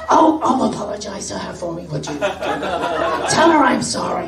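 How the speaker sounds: lightly voiced squawky lady, pitch swinging; a hint of the Marge voice, apologetic. Lady asks the storyteller to apologize to Jenny, lightly squawky. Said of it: "related, I think, to Marge Simpson".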